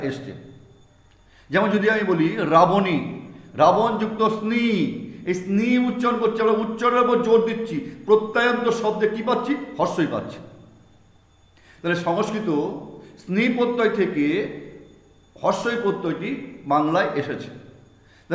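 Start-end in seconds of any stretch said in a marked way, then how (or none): none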